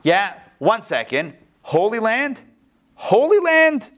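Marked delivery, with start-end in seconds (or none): 1.6-2.4 "Holy land?" asked with a rising, doubtful tone; questioning. Shown as a demonstration. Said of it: "I'll just play both sides here". 3.0-3.9 "Holy land?" much higher and louder, in disbelief; incredulous. Shown as a demonstration. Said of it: "I'll just play both sides here".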